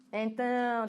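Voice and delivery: ordinary talk, nothing marked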